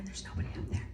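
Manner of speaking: unvoiced whisper, unnerved; hushed whisper. Guide telling Shannon the floor was empty, whispering. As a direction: breathe it out, shaken.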